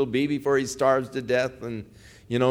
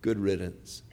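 low, softer dismissive taunt; contemptuous brush-off; 7 semitones lower, 7 dB quieter than usual. Retold or imitated. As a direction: shrug him off with quiet scorn. Brothers mock Joseph being led off.

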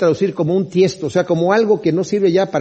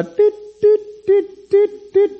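high-pitched electronic alarm beeping; imitated warning beeps; much slower than usual. Preacher mimics a red alert light going off, high repeated beeps. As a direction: beep like a little red warning light, short and even. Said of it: "la lucecita roja ya está".

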